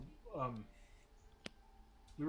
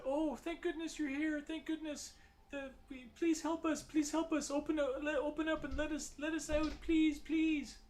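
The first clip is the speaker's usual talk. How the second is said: high, desperate pleading woman; panicked woman's voice. Acted. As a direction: beg frantically to be let out, voice pitched way up. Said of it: "it sounds like a woman's voice".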